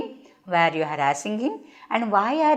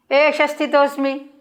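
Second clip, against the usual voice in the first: higher, defiant and curt; rude reply; 6 semitones higher, 3 dB louder than usual. Acted. Ghatotkacha answering Bhima, rude defiant reply. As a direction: throw the words back at him rudely. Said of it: "Very rudely he will say".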